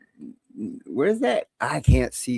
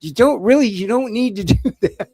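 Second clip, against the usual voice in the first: retold pleading; 5 semitones higher, 5 dB louder than usual. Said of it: "She was trying to talk me".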